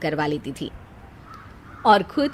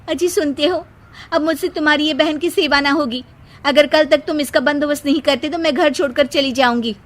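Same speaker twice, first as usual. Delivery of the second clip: high, louder ultimatum; nagging wife; 6 semitones higher, 4 dB louder than usual. Acted. Seema gives Ankit an ultimatum about his sister, high and shrill-toned. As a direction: threaten to leave, high and insistent.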